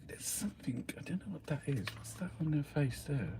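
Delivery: whispered, puzzled question. Real, not acted. Wondering aloud at a face on screen, whispered. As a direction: hush it, peering at the screen.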